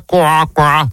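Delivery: «quoi» grave, en coassement; imitation de crapaud. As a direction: coasser plus bas que sa voix. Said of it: "Vous imitez le crapaud". (coassement de crapaud imité)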